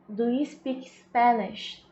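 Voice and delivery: slow model sentence. Shown as a demonstration. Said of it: "Tem o do antes".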